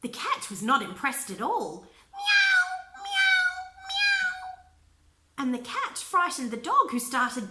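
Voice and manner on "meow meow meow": very high loud meows; cat meow. Acted. Cat hit by the baby, loud high meows.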